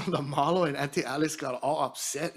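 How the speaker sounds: ordinary talk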